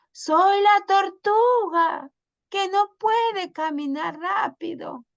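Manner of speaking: squeaky storybook animal introducing herself; teacher voicing a tiny turtle for the class; little turtle. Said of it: "turtle introduces herself".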